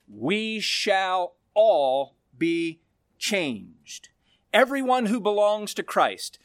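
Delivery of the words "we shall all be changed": slow, weighty, stress on "all"; "We shall ALL be changed" said very slowly with ALL hit hard; emphatic. Shown as a demonstration. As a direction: lay each word down deliberately. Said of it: "Emphatically, he says".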